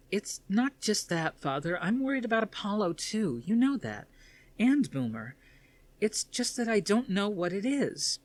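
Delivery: put-on high young woman's voice, anxious and pleading; a daughter confessing her fears to her father; worried daughter. Athena's rage drains away.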